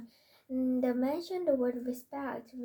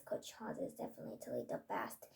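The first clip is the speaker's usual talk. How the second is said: whisper; 9 semitones lower, 10 dB quieter than usual. Acted. Whispered end of character quote.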